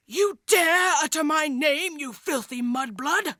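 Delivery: slow, menacing villain voice; Voldemort. Acted. Dark lord offended at hearing his name, menacing. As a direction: draw out the outrage word by word.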